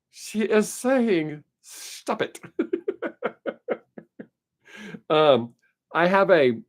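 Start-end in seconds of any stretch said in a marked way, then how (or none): 0.1-2.4 slow, mechanical mock translator voice fading to a whisper; mock Siri voice. Imitating the phone translator for laughs, slow mechanical mock voice. 2.6-3.7 breathless high whispered giggle; whispery giggle. Real, not acted. Laughing at his own Siri joke, breathless high giggle.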